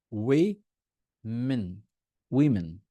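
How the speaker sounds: ওয়েমেন split wi... min, then উইমেন; slow "wi", not "o", then joined; plural women. Shown as a demonstration. Teacher drills the plural with students, "wi-min". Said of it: "তখন আর ও না".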